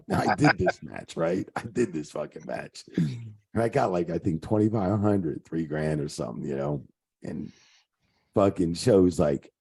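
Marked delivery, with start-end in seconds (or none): none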